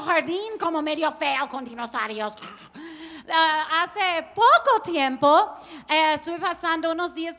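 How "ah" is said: ordinary delivery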